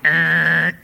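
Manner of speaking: short loud mock sneer; a sneering "er" from the crowd; sneering grunt. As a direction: scoff like the crowd sneering at Paul. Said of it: "which is an ancient way of going".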